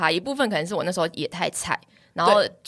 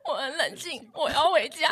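holding back tears; 7 semitones higher, 4 dB quieter than usual. Retold or imitated. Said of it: "我已经在哭了".

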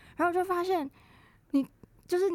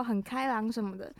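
ordinary delivery

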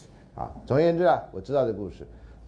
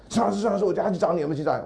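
eager lady teacher; 7 semitones higher, faster than usual. Retold or imitated. Eager female teacher quoted.

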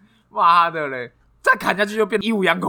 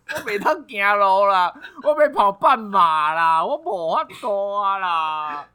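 mock sobbing victim; 5 semitones higher, slower than usual. S1 mocks crying victim, slowly.

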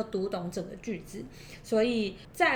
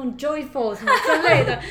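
laughing; 5 semitones higher, 11 dB louder than usual. Real, not acted. Mocking fancy word choice.

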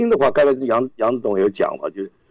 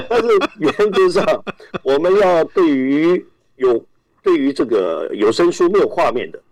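normal talking voice

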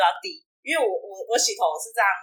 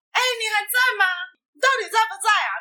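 calling out anxiously; 10 semitones higher than usual. Retold or imitated. Calling out to vanished friend. (冲着门口高声焦急喊他)

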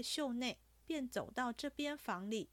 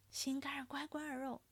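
higher, breathy whispered cooing; gushing endearments; 4 semitones higher, faster than usual. A narrator's reading. Overjoyed Jia Lian hugging Ping'er, gushing pet names. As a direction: coo sweet nothings in an airy, raised voice. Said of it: "的便乱叫起来".

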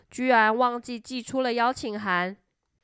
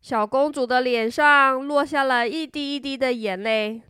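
ordinary delivery, nothing marked

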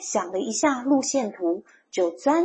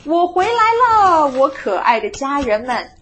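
high, loud homecoming shout; 5 semitones higher, 7 dB louder than usual. A narrator's reading. Mole greeting his family, shouting out. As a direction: holler hello to the whole family. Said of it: "鼹鼠先生钻出头大叫".

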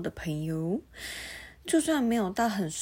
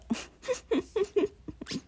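high giggle; 12 semitones higher than usual. Real, not acted. Laughs at own blunder.

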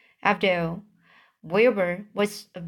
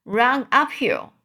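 mild, higher-pitched call; cried out; 4 semitones higher than usual. A narrator's reading. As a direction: urge him on with a lightly raised cry. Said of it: "cried the sheep".

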